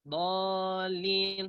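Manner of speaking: dallin said level without the lift; flat dallin; wrong dallin. Shown as a demonstration. (dallin salah tanpa nabar)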